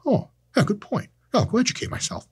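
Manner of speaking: high, sarcastic fake concession; mock agreement. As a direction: pretend to be convinced. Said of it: "was like".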